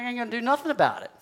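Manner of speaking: high, fast protest; defiant retort. Retold or imitated. Man refusing the nagging voice of God, high quick retort. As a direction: fire back a stubborn refusal.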